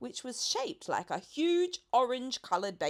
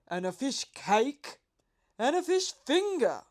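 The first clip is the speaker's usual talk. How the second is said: puzzled pelican; 5 dB louder than usual. A narrator's reading. Pelican asks the boy about fishmongers, puzzled and loud. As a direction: wonder aloud like a greedy bird.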